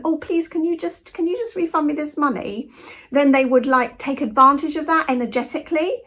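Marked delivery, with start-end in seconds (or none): 0.0-2.7 timid pleading. Retold or imitated. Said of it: "with my Anglo-Saxon".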